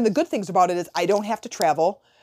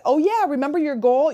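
chirpy, higher sing-song nudge; mock cheery reminder; 7 semitones higher than usual. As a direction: imitate an annoyingly upbeat friend. Someone chirps while she's in bad mood.

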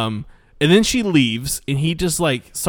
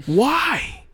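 exasperated; 10 semitones higher than usual. Retold or imitated. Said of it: "I was like".

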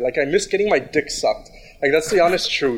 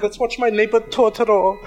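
lightly imitated Italian accent, higher pitched; 6 semitones higher than usual. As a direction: mimic her Italian accent a little, voice lifted. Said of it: "the way she says it in her Italian accent".

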